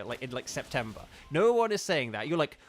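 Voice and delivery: ordinary delivery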